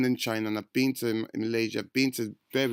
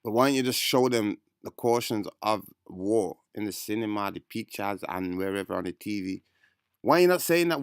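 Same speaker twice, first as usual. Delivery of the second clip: ordinary delivery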